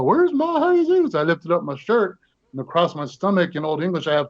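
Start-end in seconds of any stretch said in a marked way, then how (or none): 0.0-1.1 very high, drawn-out searching call; old lady calling out. Retold or imitated. Little old lady hunting for her actor, high drawn-out calling. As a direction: call the name slowly in a high voice. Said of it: "She was like".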